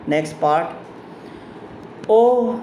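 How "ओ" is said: O, O, O with a new inflection each time, first one high; acted exclamation O. Shown as a demonstration. Teacher acting out Patal Babu's practice, varied O cries. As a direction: try out the cry O several ways. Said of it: "began giving the exclamation".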